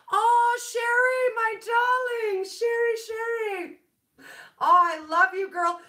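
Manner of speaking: squealing welcome to a favourite viewer; delighted greeting. Real, not acted. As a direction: call out to a friend with joy. Greets viewer Sherry in chat.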